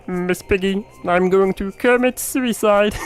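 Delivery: high, drawn-out puppet frog voice; Kermit. Acted. Streamer failing at a puppet impression, high frog voice. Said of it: "I can't do Kermit".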